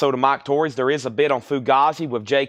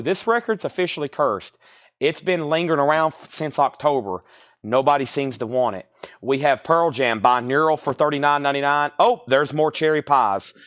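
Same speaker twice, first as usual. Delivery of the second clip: ordinary talk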